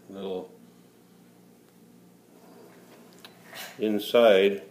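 talking around a pipe stem; words muffled by a pipe in the mouth. Real, not acted. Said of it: "I can't talk with the pipe in my mouth".

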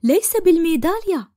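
usual speaking voice

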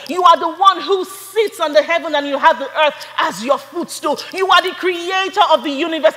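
calm naming of God building up to loud shouted praise. Shown as a demonstration. Worshipper praising God alone in their room, rising to a shout. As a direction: start calm and lift it into a full shout. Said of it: "Imagine you're like".

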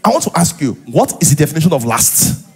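ordinary delivery

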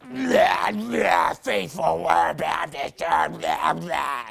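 slow, shouted demonic growl from inside the house. Retold or imitated. Retelling the voice heard from inside the house, shouted demonic imitation. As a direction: roar it out like a demon. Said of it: "the most demonic voice".

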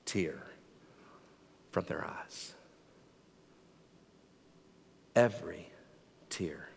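very soft, low, with long pauses; hushed scripture reading. Real, not acted. Preacher promising every tear wiped away, hushed and reverent. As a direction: let each word land almost in a whisper.

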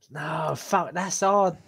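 ordinary talk, nothing marked

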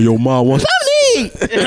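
high, laughing mock teen call; a kid calling out to his mom; teen voice cracking. Imitating a teen offering his mom food. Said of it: "You remember them days".

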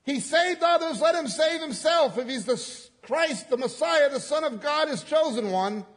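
mocking rulers sneering at Jesus; sneering mockery. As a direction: jeer at him from below the cross. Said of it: "rulers were sneering".

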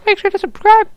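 very high silly voice; goofy high-pitched outro; squeaky sign-off. Acted. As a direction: chirp it like a cartoon.

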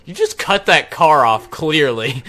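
yelling at a reckless driver; loud and incredulous. Real, not acted. Shouting at swerving car.

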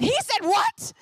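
high, loud, incredulous yelp; shocked disbelief. Retold or imitated. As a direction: squeal it in total disbelief. Shocked retort to missionary.

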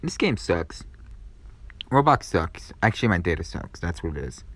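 quiet deflated sulk; dejected grumble. Real, not acted. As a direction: let the defeat sink in. Deflated after losing.

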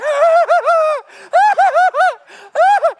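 high loud laughing peal; real belly laugh; big burst of laughter. Real, not acted. Laughs at own story.

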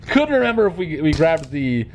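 usual speaking voice

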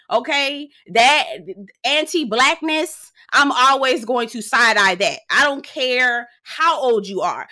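loud, slow, emphatic scolding; heated rant. Real, not acted. Vlogger scolding a reality star over his old tweets, loud and slow. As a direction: drag out each word to make the point.

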